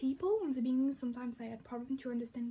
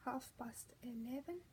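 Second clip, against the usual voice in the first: half past eleven, almost under the breath; murmured self-correction; soft correction; 11 dB quieter than usual. Shown as a demonstration. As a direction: quietly correct yourself.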